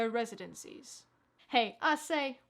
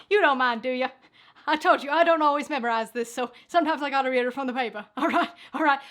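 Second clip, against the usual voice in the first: loud teasing; 10 dB louder, faster than usual. Real, not acted. Loud teasing of offscreen producer.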